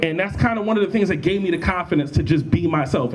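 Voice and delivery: ordinary delivery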